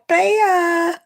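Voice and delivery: squeaky high, stretched out; teasing sing-song jab; sing-song mock. Real, not acted. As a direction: fling the word up high in mockery. Mocking a player, pitch leap.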